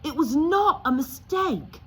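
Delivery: put-on high, loud boy's anger; angry. Harry to Uncle Vernon, angry. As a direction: push back hard. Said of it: "said Harry angrily".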